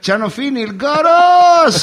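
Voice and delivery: grido acuto e forte, vocali tirate; esclamazione gridata. Acted. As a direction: annuncialo urlando, lento e altissimo. Esclamazione gridata nel racconto comico. (urlo comico ed entusiasta)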